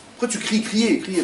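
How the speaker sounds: high, loud sermon voice, hammering the point; a preacher shouting to his flock; fiery preaching. Acted. Preaching at full force.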